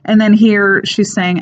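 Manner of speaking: ordinary delivery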